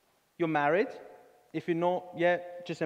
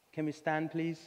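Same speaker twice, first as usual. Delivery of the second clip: ordinary talk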